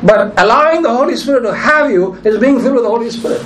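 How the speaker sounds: usual speaking voice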